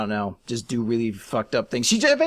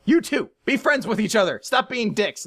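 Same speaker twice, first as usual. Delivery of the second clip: lightly voiced stern scolding, higher pitch; a hint of a strict disciplinarian; Urza; 7 semitones higher than usual. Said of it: "this very disciplinary figure".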